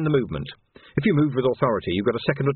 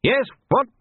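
high, loud, blustering query; irritable captain; 5 dB louder than usual. Acted. Captain answers his interrupting jailer, irritably. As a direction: snap back at the interrupter, pitch shooting up.

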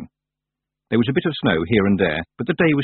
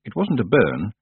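usual speaking voice

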